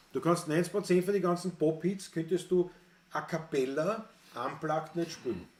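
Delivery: usual speaking voice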